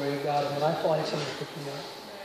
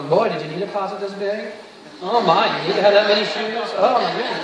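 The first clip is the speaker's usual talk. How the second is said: nosy houseguest; 2 semitones higher, 7 dB louder than usual. Retold or imitated. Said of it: "walk in your house and go".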